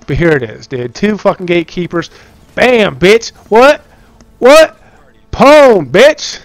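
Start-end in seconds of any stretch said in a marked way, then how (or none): 2.5-6.4 much higher and louder, gloating; triumphant taunt. Real, not acted. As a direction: crow over the win. Taunting after boss kill.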